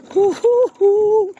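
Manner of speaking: high, loud whooping hoos; excited whoops. Real, not acted. Rider whoops to viewers on the descent, excited. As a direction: whoop with the thrill of the ride. Whooping while riding downhill.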